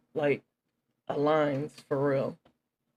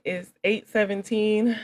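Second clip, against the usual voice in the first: upbeat host introduction, slow and loud; announcer intro; 4 dB louder, slower than usual. Real, not acted. Formal course introduction.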